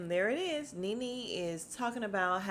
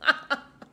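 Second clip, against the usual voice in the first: a sudden loud cackle of real amusement; loud burst of laughter; 6 semitones higher, 8 dB louder than usual. Real, not acted. Recapping the show, she laughs out loud. Laughing at her own retelling.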